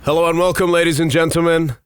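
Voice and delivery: normal talking voice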